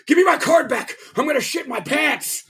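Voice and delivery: very high desperate customer imitation; hammed-up bathroom emergency; mock panic. Retold customer desperate for a toilet.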